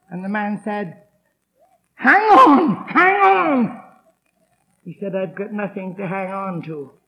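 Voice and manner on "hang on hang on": high, loud urging; urgent encouragement. Retold or imitated. Pub chum urges the dying man to hold on. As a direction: urge him to hold on.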